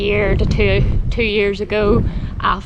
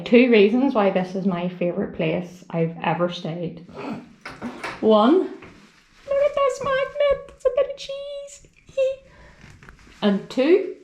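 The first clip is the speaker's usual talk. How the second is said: ordinary talk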